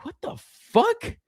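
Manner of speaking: high, exasperated groan of a line; retelling the mailbox break-in, high and exasperated; mock exasperation. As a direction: throw your hands up in disbelief. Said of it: "And I was like".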